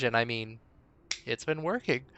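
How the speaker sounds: usual speaking voice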